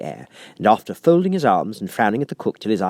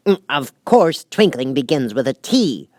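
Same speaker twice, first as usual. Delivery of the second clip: sharp; 5 semitones higher, 5 dB louder than usual. A narrator's reading. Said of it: "said the King sharply".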